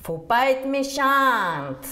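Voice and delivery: put-on lent, fort et aigu, accent du Midi; crié avec accent du Sud. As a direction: hurler avec l'accent du Sud, lentement. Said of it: "quand elle a hurlé". (la centenaire hurle son secret, accent du Sud)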